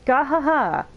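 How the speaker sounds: high, loud hammed-up gahaha laugh "Gahaha"; hearty mock laugh. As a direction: belt out "Gahaha" like a boastful hero. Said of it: "No, sorry".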